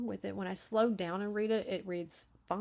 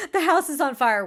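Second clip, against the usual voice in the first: loud, raised-pitch mock panic; mock alarm; 6 semitones higher, 15 dB louder than usual. Teasing chill dads about a house fire, mock-alarmed shout. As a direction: blurt it out in exaggerated alarm.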